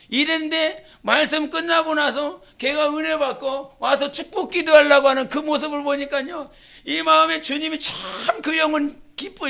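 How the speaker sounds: ordinary talk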